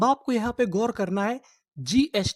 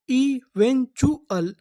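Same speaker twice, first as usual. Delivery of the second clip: slow drawn word; 3 semitones higher, much slower than usual. Shown as a demonstration. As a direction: stretch eventual out clearly. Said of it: "तो इतनी meaning क्या बनी?". (धीरे और ज़ोर से)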